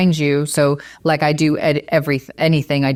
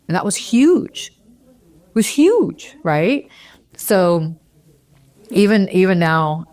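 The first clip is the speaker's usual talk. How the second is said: choked up; 3 semitones higher, slower than usual. Real, not acted. Moved to tears retelling story.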